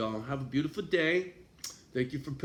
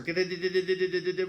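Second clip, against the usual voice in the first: a big burst of real laughter, higher; loud cackling laugh; 5 semitones higher, 5 dB louder than usual. Real, not acted. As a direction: crack up at your own joke. Laughing at his own joke.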